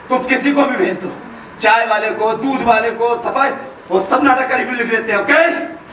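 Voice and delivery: usual speaking voice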